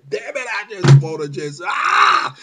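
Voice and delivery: loud frustrated yell ending in a rough cry; angry outburst. Retold or imitated. Picturing his own urge, shouting in fury. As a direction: let it explode, then break into a scream. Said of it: "the more I just wanna say".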